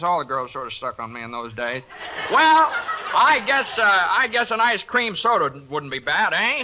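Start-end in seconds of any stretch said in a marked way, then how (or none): 2.3-6.6 high, loud cocky invite; swaggering offer. Acted. Biff treats the girls to sodas, high and swaggering. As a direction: show off to the girls.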